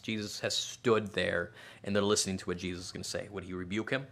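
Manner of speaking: usual speaking voice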